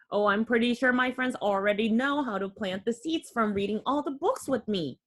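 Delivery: ordinary delivery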